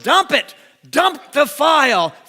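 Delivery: loud, high, commanding; emphatic command. Acted. Preacher telling the congregation, loud command. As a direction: bark the order at them.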